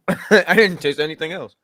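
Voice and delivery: laughing through the words. Real, not acted. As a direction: say it while cracking up. Repeats line, laughing at sauce.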